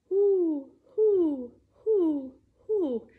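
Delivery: high, loud owl hoots. Pretend. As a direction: hoot like an owl at night. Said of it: "like an owl".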